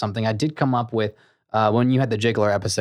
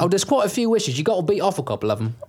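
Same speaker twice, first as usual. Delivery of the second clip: high put-on British dad voice; hammed-up accented father; bossy dad; 8 semitones higher than usual. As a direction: order the kid outside in a silly accent. Skit: father voice.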